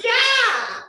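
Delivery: high, loud, eager yeah; eager yes. Pretend. The coach's voice answering her, high eager yes. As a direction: squeal a happy yes as the voice.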